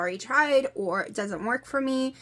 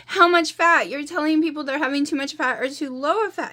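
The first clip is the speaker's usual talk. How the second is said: exasperated retort; 6 semitones higher, 5 dB louder than usual. Retold or imitated. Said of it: "I'm like".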